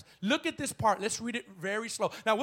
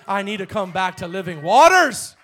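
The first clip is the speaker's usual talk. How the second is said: loud cry; 13 dB louder than usual. Real, not acted. Emphatic cry.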